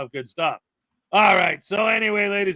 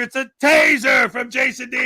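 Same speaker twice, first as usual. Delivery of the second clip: loud, higher announcement with TASER punched; loud call-out; 5 semitones higher, 7 dB louder than usual. Acted. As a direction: call it out big and high, like a hype man. Calling out the donor's joke.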